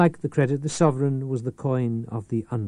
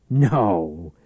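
snarl; 7 dB louder than usual. Acted. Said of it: "with a snarl that was half hysterical laughter".

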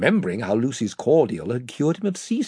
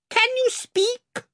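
very high, slow, shrill question; slower than usual. A narrator's reading. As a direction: squeak it out shrilly. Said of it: "came his shrill voice".

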